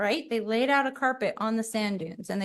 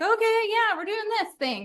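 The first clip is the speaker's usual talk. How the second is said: eager play-along; 9 semitones higher than usual. Retold or imitated. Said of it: "We're like".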